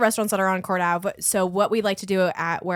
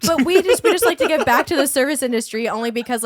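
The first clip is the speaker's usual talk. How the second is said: excited; 6 semitones higher, 6 dB louder than usual. Real, not acted. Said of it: "you're getting excited".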